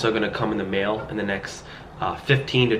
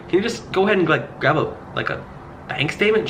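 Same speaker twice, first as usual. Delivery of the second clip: higher, breezy, casual ask; light helpful suggestion; 8 semitones higher than usual. Retold or imitated. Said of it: "And I'm like".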